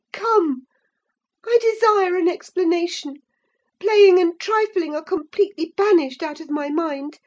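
high, loud impatient questions; indignant demand. A narrator's reading. Catherine demanding an explanation from Linton. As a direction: press him hard for the truth.

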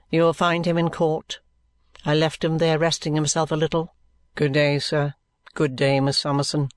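low, level, reserved voice; dry, subdued lawyer. Acted. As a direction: take leave in a low monotone. Vholes speaking in story.

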